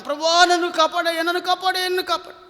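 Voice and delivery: high-pitched, acted cry for rescue; desperate plea. Acts out a too-late prayer.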